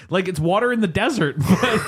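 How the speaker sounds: usual speaking voice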